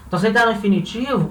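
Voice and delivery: ordinary talk, nothing marked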